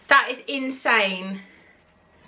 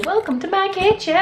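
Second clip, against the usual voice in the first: higher, singsong welcome; cheery host greeting; 10 semitones higher than usual. Pretend. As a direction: greet viewers brightly.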